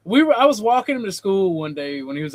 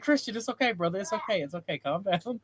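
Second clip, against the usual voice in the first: soft, hushed reassurance; calming him; 9 dB quieter than usual. Retold or imitated. Driver soothing his scared brother after the spin, softly. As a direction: gently talk a panicking brother down. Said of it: "I was like".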